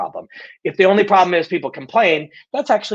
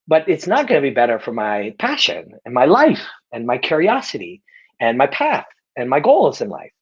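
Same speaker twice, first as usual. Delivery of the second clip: ordinary talk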